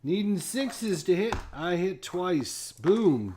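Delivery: gleeful hype over a lucky roll; excited. Real, not acted. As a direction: cheer the dice roll like a win. Rolling dice, scoring hits.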